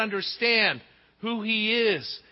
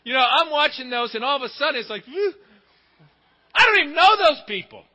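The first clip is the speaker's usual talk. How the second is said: mock sob; 6 semitones higher, 9 dB louder, faster than usual.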